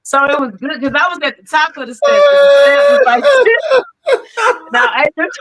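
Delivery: very high, breaking into laughter; can barely get it out for laughing; laughing hard. Real, not acted. Guest cracking up recalling the fallen skirt, very high.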